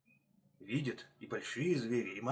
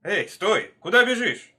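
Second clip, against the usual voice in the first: loud, high-pitched call; urgent raised-voice challenge; shouting; 7 semitones higher, 12 dB louder than usual. A narrator's reading. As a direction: shout after a runner to stop. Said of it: "кричат они".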